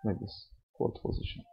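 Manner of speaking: soft unvoiced breathy words; breathy whisper. Shown as a demonstration. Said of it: "During quiet respiration".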